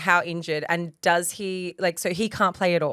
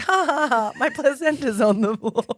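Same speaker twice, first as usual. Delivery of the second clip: slow, higher-pitched dazed voice; mock spaced-out; 7 semitones higher, 4 dB louder than usual. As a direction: act out someone drugged and amazed. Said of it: "'Cause she's so high".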